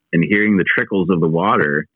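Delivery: ordinary delivery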